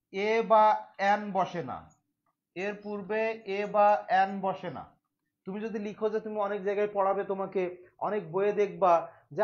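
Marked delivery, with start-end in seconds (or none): 0.1-5.0 very slow, word-by-word teacher dictation; measured pace with drawn-out words. Shown as a demonstration. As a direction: spell the rule out for note-takers, unhurried. Dictating a rule to be written.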